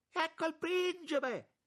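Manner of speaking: very high, loud announcing cry; piercingly high shout of announcement; exclaiming. A narrator's reading. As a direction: call out the arrival, drawn out. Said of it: "esclamò donna Sara".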